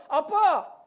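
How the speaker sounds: loud call to a father; calling for dad. Retold or imitated. Boy at the big slide calls his father, calling out. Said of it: "다시 아빠를 불렀습니다".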